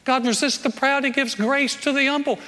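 ordinary talk

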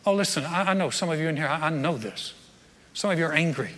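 ordinary talk, nothing marked

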